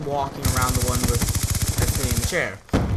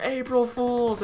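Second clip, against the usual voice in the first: mock scream; 7 semitones higher, slower than usual. Pranksters revealing the joke to class, mild mock scream. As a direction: imitate a gleeful reveal without full volume. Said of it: "they just screamed".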